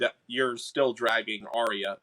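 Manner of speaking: normal talking voice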